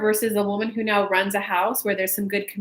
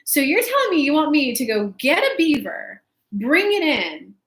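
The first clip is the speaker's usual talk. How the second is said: naive disbelief; 6 semitones higher, 4 dB louder than usual. Retold or imitated. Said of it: "I was like".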